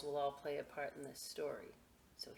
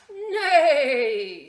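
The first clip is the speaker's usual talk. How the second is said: horse neigh; 9 semitones higher, 17 dB louder than usual. Acted. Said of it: "said Horse".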